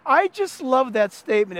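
normal talking voice

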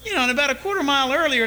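ordinary talk

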